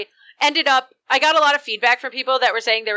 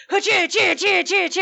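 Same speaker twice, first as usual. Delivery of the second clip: imitated bone-ripping noise; 7 semitones higher than usual. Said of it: "just be like".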